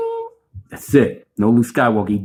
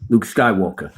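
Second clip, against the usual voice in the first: ordinary talk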